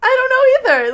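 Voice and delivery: high, loud outburst; exasperated. Real, not acted. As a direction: throw up your hands, loud.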